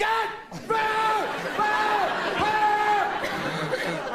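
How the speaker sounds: high, loud, hammed-up screams; mock screams. People screaming in big quake.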